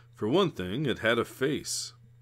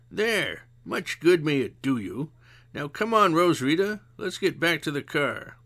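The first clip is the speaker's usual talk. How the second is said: lightly voiced older woman, higher; a hint of a weary old friend; mild tired sigh of a voice; Missus Zimmerman; 5 semitones higher, 3 dB louder than usual. A narrator's reading. Zimmerman pays angrily.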